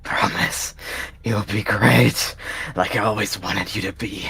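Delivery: faltering whisper, slow; stammered fading plea; dying whisper. Acted. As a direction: whisper with your last breath. Dying cat's last plea.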